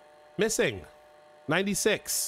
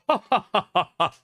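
creepy laugh; 6 semitones higher, 15 dB louder than usual. Acted. Spooky host laugh opening next game.

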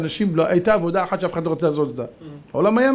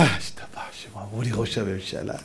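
much lower, very soft murmur; hushed savouring; 12 semitones lower, 9 dB quieter than usual. Acted. Vain leader repeats his title to himself, hushed savouring. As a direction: savour the title under the breath.

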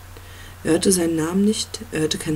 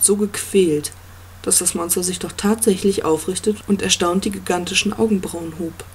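ordinary delivery

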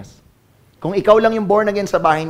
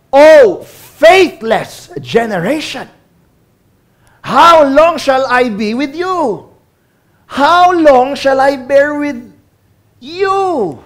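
slow, higher, loud grieving rebuke; Jesus rebuking; 4 semitones higher, 4 dB louder, much slower than usual. Acted. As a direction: lament over the faithless generation, drawn out slowly. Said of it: "He answered him and said". (mabagal at malakas na pagsaway)

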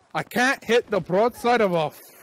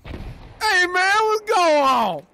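high, loud mock Detroit accent; hammed-up, drawn-out Detroit accent; 12 semitones higher, 6 dB louder, much slower than usual. Shown as a demonstration. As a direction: holler it in an exaggerated Detroit accent. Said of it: "show us your best Detroit accent".

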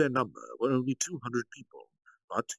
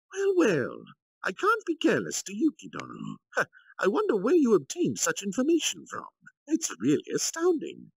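much higher, louder sly general; Kalgurio, feigned admiration; 12 semitones higher, 5 dB louder than usual. Acted. Kalgurio probes Yuki before the council, high, loud, sly. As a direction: fake praise with a cunning edge.

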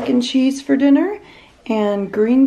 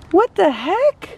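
delighted surprise; 8 semitones higher, 4 dB louder, slower than usual. Real, not acted. Mom surprised by visitors' gift.

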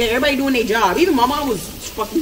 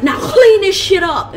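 very high, loud, slow command; barked order; 11 semitones higher, 9 dB louder, slower than usual. Retold or imitated. Ordering her family before walking out, high and loud. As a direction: snap the final order and leave.

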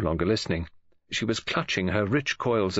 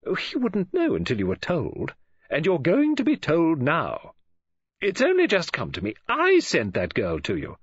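higher voice, slowing into the disclosure; revelatory woman friend; 8 semitones higher than usual. Acted. Woman friend reveals she sent the girl, emphatic. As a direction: announce it with weight, leaning on I.

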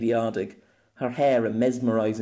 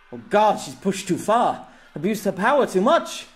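high, loud, horrified cry; mortified exclamation; 9 semitones higher, 5 dB louder than usual. A narrator's reading. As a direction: exclaim it in alarm, pitch leaping. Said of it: "Jem exclaimed, mortified".

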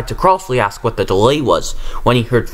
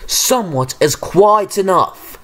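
stern boom; 7 semitones higher than usual. A narrator's reading. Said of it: "Sir Topham Hatt boomed".

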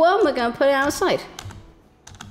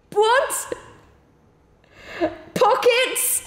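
much higher startled question; surprised; much slower than usual. Real, not acted. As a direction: caught off guard. Surprised by cat in game.

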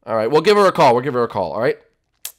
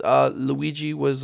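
ordinary delivery

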